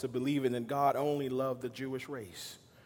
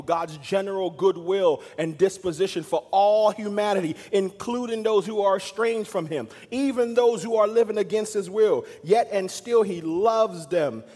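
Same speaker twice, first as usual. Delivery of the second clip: preaching; 5 semitones higher, 6 dB louder than usual. Acted. Preacher building to a climb.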